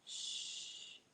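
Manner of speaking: soft unvoiced shush. Real, not acted. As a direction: hush the room gently. Hushing for the parrot.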